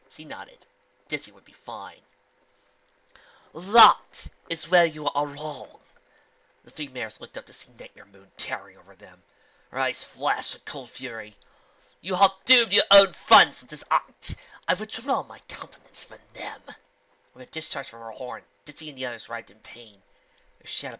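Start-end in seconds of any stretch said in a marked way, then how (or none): 3.5-5.8 slow, loud menacing villain voice. Acted. 12.0-16.6 cold evil queen. Acted. Evil queen pronounces doom.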